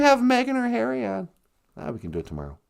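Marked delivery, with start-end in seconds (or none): 1.8-2.6 deep fast put-on voice; low put-on voice. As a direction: drop into a low, quick comic voice.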